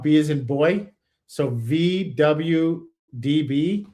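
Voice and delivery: slow, careful spelling out; dictating. Real, not acted. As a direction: read each letter slowly for writing down. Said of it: "I want you to write this number down".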